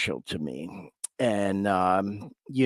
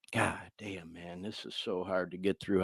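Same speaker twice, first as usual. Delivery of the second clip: much softer, choked-up and holding back tears; fighting tears; 5 semitones lower, 14 dB quieter than usual. Real, not acted. Thanking Carol on air, softly choked up and close to tears. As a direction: near crying, barely getting the words out. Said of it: "I don't wanna start crying".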